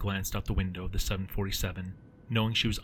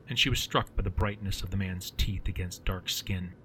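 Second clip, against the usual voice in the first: usual speaking voice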